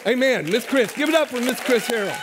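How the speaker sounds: ordinary talk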